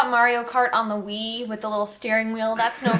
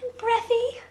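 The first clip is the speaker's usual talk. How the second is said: delicate, high, airy bimbo voice; breathy blonde girl; 11 semitones higher than usual. Shown as a demonstration. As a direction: play a ditzy blonde, breathy and pitched way up. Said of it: "very delicate and breathy and blonde sounding".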